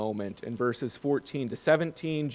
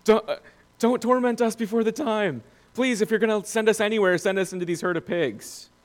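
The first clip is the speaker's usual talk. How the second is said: high, louder mock begging; hammed-up panicky plea, pitched way up; terrified pleading; 8 semitones higher, 4 dB louder than usual. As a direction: play frightened demons begging for mercy. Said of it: "the demons are terrified of Jesus".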